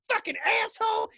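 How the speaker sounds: high, loud, fast mock scream; mock raving yell. Mimicking the lady as a street crazy, shrieking. Mocking a ranting street person.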